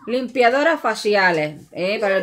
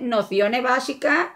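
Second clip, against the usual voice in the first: usual speaking voice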